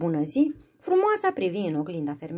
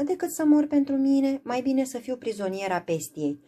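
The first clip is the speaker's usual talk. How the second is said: pleading cry; 7 semitones higher, 3 dB louder than usual. Acted. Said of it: "strigă Frumoasa".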